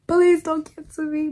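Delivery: hammed-up plea not to be cancelled; mock pleading. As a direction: beg the audience in mock panic. Louder pleading repeat.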